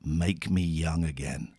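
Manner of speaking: low, slow quoted cry; understated cry, slow and low; retold plea. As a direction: imitate a weary plea, drawn out. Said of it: "Kilgore Trout cried".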